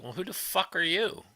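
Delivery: high, mocking who-are-you jab; scoffing retort. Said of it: "I was like".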